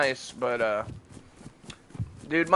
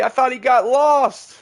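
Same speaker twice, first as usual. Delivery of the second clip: loud, high, slowed; 9 semitones higher, 10 dB louder, slower than usual. Real, not acted. Repeated, second louder.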